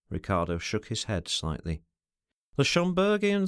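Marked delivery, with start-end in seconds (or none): none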